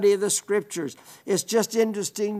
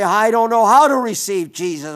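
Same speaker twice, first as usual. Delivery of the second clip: louder mock protest; imitated doubter speaking up; objecting listener; 3 semitones higher, 7 dB louder than usual. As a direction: voice a listener's helpless objection, loudly.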